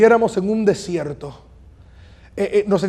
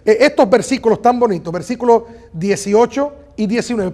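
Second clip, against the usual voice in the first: ordinary talk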